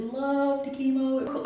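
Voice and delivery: high, drawn-out relish; singsong gushing. Real, not acted. Survivor tells how she liked chemo, singsong gushing. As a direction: stretch it into a little tune.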